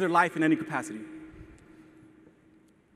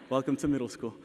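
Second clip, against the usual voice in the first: laughing aside; 4 semitones lower, 6 dB quieter than usual. Real, not acted. Laughing aside about nervous twins.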